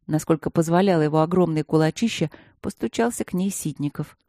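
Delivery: ordinary delivery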